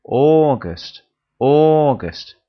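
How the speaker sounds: loud drilled "August." with falling tune; teacher modelling. Shown as a demonstration. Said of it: "the best month of the lot".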